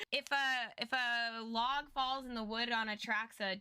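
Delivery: ordinary talk, nothing marked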